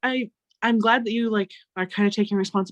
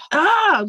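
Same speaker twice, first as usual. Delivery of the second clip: short high yelp of frustration; mock toddler shriek; frustrated toddler shriek; 9 semitones higher, 5 dB louder than usual. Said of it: "she's like".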